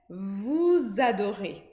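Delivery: vous adorez drawn out, Z linked to adorez; slow liaison. Shown as a demonstration. Teacher slowly models vous adorez for students. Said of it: "Então, ficaria".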